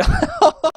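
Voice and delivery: sudden high burst of real laughter. Real, not acted. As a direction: crack up at the play.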